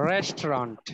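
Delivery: low, falling "রেস্টুরেন্ট" said rest-ront; English pronunciation. Shown as a demonstration. Teacher repeating the English form, "রেস্টুরেন্ট" as low rest-ront. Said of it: "এটার উচ্চারণ কি দেখো".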